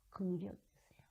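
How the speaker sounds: whispered prayer for mercy; whispered plea. Shown as a demonstration. Preacher shows a whispered prayer, hushed whisper. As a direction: breathe the plea almost silently.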